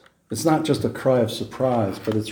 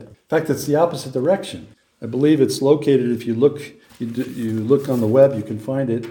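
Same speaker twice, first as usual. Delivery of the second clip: ordinary talk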